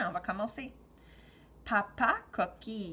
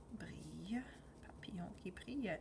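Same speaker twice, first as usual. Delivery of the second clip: whispering; 10 dB quieter than usual. Shown as a demonstration. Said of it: "teacher whispers the repeat".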